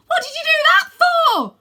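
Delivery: high, loud desperate shout; shrill-free loud cry of alarm; Rose cries out. Acted. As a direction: cry it out across the pit. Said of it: "she cries".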